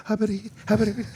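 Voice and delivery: high, garbled mock mumbling; mumbled gibberish. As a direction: mimic someone unintelligible. Imitating mumbled speech.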